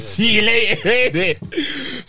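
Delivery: high, cracking up mid-line; laughing taunt. Retold or imitated. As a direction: laugh it out as a joke. Laughing mock reply.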